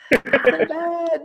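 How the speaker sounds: drawn-out high-pitched wince; cringing repeat. Real, not acted. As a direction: echo the protest up high in mock despair. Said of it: "Don't make me do it again".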